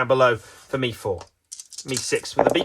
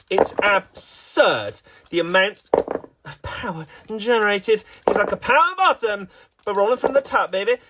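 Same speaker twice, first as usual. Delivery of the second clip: mock commentator hype; 6 semitones higher than usual. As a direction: pitch climbing in playful amazement. Mock commentator hypes the player.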